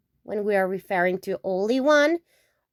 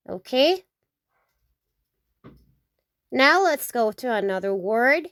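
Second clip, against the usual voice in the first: ordinary talk, nothing marked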